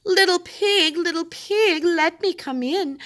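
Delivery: high, sugary put-on voice calling at the door; sweet disguised shark. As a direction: shark faking a sweet lady's voice. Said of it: "called sweetly".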